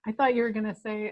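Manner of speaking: ordinary talk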